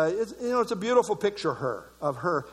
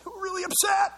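mock indignant; 11 semitones higher than usual.

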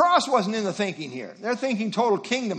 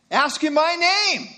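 higher, louder and slow, with the weight falling on name in Ask in my name; emphatic command; 7 semitones higher, 5 dB louder, slower than usual. Acted. Preacher driving home Jesus' command to the congregation, emphatic. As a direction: proclaim Ask in my name with full force. Jesus making a very strong point.

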